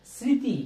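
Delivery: "স্মৃতি" as sriti, with no m; clearly spoken "স্মৃতি" with silent ম. Shown as a demonstration. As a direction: drop the ম in "স্মৃতি". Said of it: "কোনো কাজেরই না".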